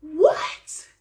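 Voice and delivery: high loud disbelieving what; shocked what. Retold or imitated. Vlogger reliving her shock at his words, high loud disbelief. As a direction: spit out the shock. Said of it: "I was just like".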